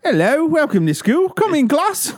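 very high-pitched bad British accent; terrible British lady. Friend mimicking dad's storytime schoolteacher, terrible British accent. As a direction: pipe it up as a hammed-up British teacher. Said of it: "I do a terrible British lady".

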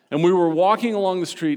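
ordinary talk

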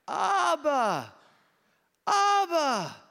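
high, loud imitated child's wail; child crying out. Hebrew boy crying for his father, high drawn-out yell. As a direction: call out for father like a small child. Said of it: "And the kid cried".

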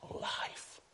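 soft, whispered single word. Acted. Voice of Jesus speaks into the grave, whispered.

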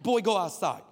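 a firm, loud call; Chris Estrada version. Shown as a demonstration. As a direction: bellow it like God calling out. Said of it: "This is the Chris Estrada version".

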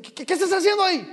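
angry pastor. Retold or imitated. Pastor scolding a late young worker, mock angry. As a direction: snap at him like the angry pastor. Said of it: "echando pestes".

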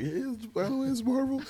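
high, small put-on voice; tiny sheepish aside. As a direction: squeak it out like small print. Voicing the tiny hidden logo.

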